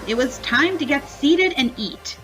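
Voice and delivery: usual speaking voice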